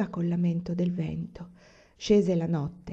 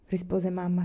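ordinary delivery

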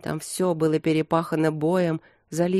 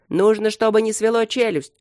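raised, higher, louder voice; urgent shout; 5 semitones higher, 5 dB louder than usual. A narrator's reading. Someone orders help for the convulsing king, urgent shout. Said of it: "крикнул кто-то".

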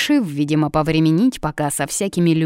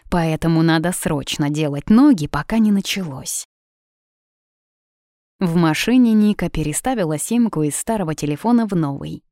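usual speaking voice

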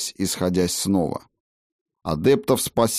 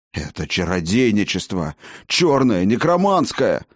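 outraged denunciation; 2 semitones higher than usual. Acted. Master recoiling from dark sorcery, outraged. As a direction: denounce it in rising horror and anger. Appalled master denounces necromancy.

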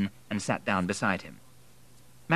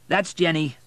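raised, louder bartender voice; bartender's line; 9 semitones higher, 6 dB louder than usual. A narrator's reading. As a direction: call it out as the bartender.

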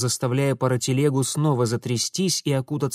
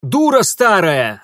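loud, higher, drawn-out angry bark; barked insult; 6 semitones higher, 9 dB louder, slower than usual. A narrator's reading. Mitya insults the old woman attacking him, angry bark. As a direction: snap back with full force. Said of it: "рявкнул он".

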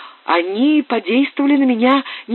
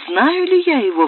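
joyful cry; 7 semitones higher than usual. A narrator's reading. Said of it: "вскричала я с радостными слезами на глазах".

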